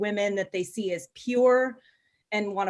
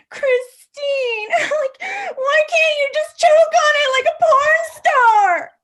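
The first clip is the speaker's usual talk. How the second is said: put-on high, loud, crying whine like a kid begging for a toy; whiny husband; 9 dB louder, slower than usual. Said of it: "whining for a toy".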